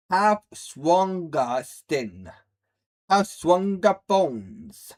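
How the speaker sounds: slow, deliberate advice; slow lecture. Real, not acted. Streamer lecturing viewers on toughness, slow and deliberate. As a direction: spell it out slowly to the viewers.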